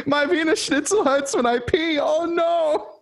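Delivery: very high, silly put-on German whine; hammed-up German complaining about a sore kidney; mock German voice. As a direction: squeal it like a whiny cartoon Nazi. Mocking German Nazi joke voice.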